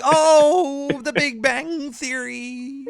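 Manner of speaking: high, slow, awed; drawn-out gushing wonder; mock awe. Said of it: "And we're like".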